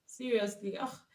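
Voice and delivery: normal talking voice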